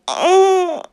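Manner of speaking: sharp loud groan of pain; loud pained cry. Real, not acted.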